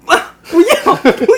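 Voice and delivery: high, loud hammed-up cries of no; mock girlfriend crying out. Girlfriend hitting her head on the wall in the dark, hammed-up high cries. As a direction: squeal it through laughter.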